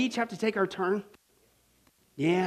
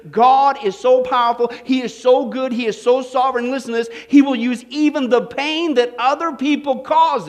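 louder, rising emphatic preaching; preaching at full force; 3 semitones higher, 5 dB louder than usual. Real, not acted. Preacher building to a loud climax for the congregation. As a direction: build it up and proclaim it.

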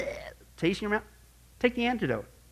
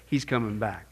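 usual speaking voice

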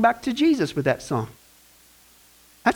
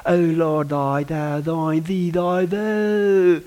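lower, hammed-up churchy prayer voice; mock pious prayer; 4 semitones lower, much slower than usual. Pastor mocking a congregant's archaic prayer, in a hammed-up pious voice. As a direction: parody an old-fashioned praying brother.

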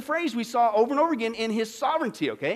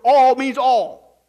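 ALL drawn out and very loud; emphatic stress; 4 semitones higher, 12 dB louder, much slower than usual. Acted. Preacher insisting the promise covers everything, emphatic on ALL. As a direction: boom both ALLs slowly.

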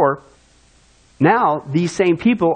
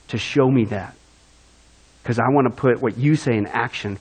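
lowered repentant inner voice; 7 semitones lower, 3 dB quieter than usual. Retold or imitated. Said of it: "at first I was like".

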